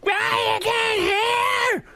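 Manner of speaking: high, slow drawn-out yell; mock yell. Comedian imitating his mother yelling at deaf dad, high slow shout. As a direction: stretch out the shout like an angry old woman. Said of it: "I hear, like".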